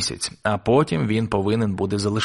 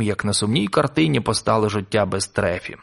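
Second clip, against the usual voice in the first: plain reading voice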